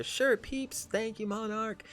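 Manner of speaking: high put-on character voice; character thanks. Max thanking Monarch for the dice in a high voice. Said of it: "Just, uh".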